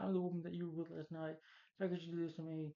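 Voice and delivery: high-pitched mock crying plea; imitated tearful plea. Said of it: "She's crying".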